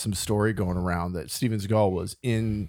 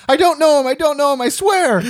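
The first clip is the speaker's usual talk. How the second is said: high, loud mock-terrified yelp; shrieked denial in play-acted panic; panicked pleading; 7 dB louder than usual. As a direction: plead frantically, hammed up. Playing the terrified man being interrogated.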